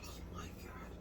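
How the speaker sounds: a stunned, breathless whisper; whispered oh my God of delight; awed whisper. Real, not acted. Awed at taco smell.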